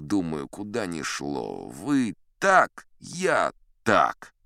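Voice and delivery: plain reading voice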